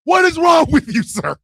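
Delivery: loud, high-pitched scolding; exasperated outburst. Real, not acted. Host scolding the commenter stuck in his head, loud exasperation. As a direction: burst out in amused outrage.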